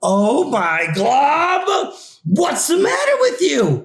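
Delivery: mom jumping at her jittery son; startled mom. Acted. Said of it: "his mom asked".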